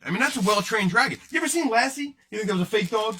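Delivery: ordinary talk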